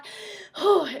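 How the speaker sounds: heavy sigh of remembered distress; exasperated exhale. Retold or imitated. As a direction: blow out a breath at the misery. Recalling desperate bathroom need.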